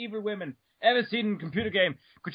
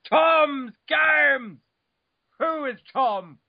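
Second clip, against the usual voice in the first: exasperated emphasis; 6 semitones higher, 3 dB louder, much slower than usual. Real, not acted.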